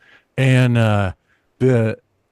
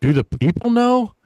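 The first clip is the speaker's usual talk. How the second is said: high, worried little question; nervous question; 10 semitones higher than usual. Retold or imitated. Comic asking the manager, anxiously high. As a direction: ask it anxiously, pitched way up. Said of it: "And I was like".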